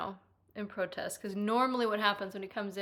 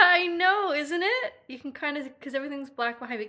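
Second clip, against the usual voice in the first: usual speaking voice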